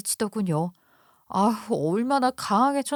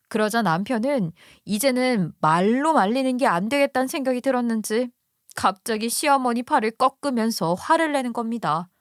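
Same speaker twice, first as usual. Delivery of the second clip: ordinary delivery, nothing marked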